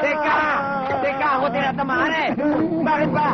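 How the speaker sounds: very high, squealed plea; alarmed protest. Acted. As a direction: cry out as the blows land on you. Bystander hit during acted scuffle.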